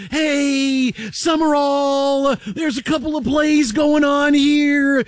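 high, drawn-out commentator impression, words stretched slowly; John Madden. Host defending his impression to his co-host, hammed-up high, slow broadcaster voice. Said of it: "That's a pretty good Madden impression".

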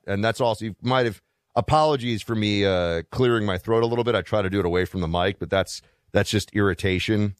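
ordinary delivery

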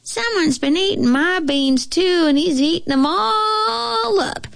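high squeaky wailing cub; itty-bitty baby voice, very high; little baby bear. A narrator's reading. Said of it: "squeaked the bear cub".

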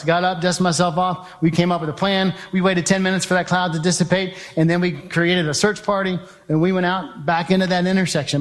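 ordinary delivery

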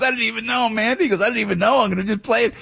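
high, laughing Bruce impression; imitating Bruce. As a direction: play him amused and giddy. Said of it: "He was laughing".

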